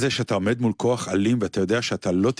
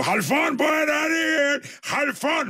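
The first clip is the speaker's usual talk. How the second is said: much higher, hammed-up yelling of a name; comic shout; slower than usual. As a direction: bellow for Chalfon across the yard. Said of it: "שהוא צועק לו". (צעקה קומית וגבוהה)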